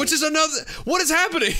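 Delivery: high laughing disbelief. Real, not acted. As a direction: giggle it out, bewildered. Laughing at the confusion.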